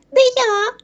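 very high squeaky mouse voice; squeaking. A narrator's reading. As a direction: squeak a drawn-out refusal. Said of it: "пишіть Верть".

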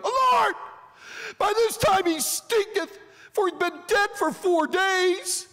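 high, loud, exclaiming protest; hammed-up, high-pitched outburst; Martha protesting.

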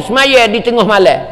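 ordinary talk, nothing marked

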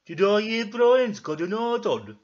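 loud, drawn-out, high character voice; slow, loud busybody; Ermintrude. Acted. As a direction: boom out a nosy question, slow and high. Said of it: "said Ermintrude".

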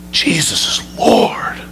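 "Jesus is Lord." whispered slowly in awe; hushed reverent whisper; awed whisper. Shown as a demonstration. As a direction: breathe "Jesus is Lord." as a sudden realization.

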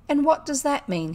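high, loud, angry question; furious demand. A narrator's reading. The gentleman confronts the boys over the card, furious. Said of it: "pink with fury".

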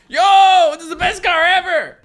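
loud, high yell of joy; excited shout. Real, not acted. Gamer thrilled his car came back, loud and high. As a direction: shout it like you just won the lottery.

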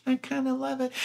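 high, soft, coy imitation; Gladys Glover voice. Gladys confessing she loves the billboard, high coy voice. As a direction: coo it high and a bit softly.

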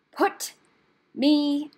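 loud, word by word demand; furious, spaced-out command; angry snarl. A narrator's reading. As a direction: snarl each word separately, starting high. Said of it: "snarled Rat".

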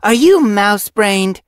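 loud, high, slow scolding; snapped. A narrator's reading. Apprentice scolds her reckless friend, snapping. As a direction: scold with stretched-out exasperation. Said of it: "she snapped".

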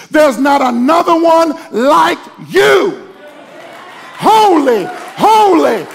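fiery pulpit shouting; preaching at full force. Acted. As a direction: build the congregation to a roar.

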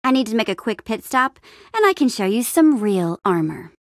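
ordinary talk

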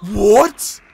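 ordinary delivery

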